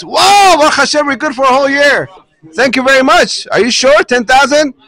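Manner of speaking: whoa shouted high, then a bright excited run; overjoyed at an unexpected windfall; amazed joy. Retold or imitated. Husband thrilled at the dealer's ten thousand dollar offer, excited. Seller stunned by big offer.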